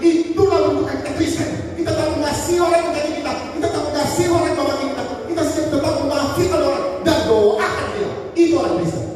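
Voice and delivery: preaching at full force. Acted. Pastor exhorting the congregation, fervent high-pitched preaching. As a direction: rise to a fervent, high-pitched sermon peak.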